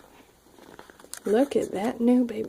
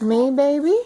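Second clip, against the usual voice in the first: ordinary delivery, nothing marked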